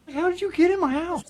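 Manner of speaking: quick, high, amused disbelief at the snake; startled mock question. Finding a snake by the dryer, mock startled high quick question. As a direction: rattle it off high and fast like a shocked homeowner. Snake found outside bathroom.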